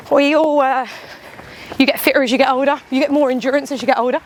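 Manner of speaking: ordinary talk, nothing marked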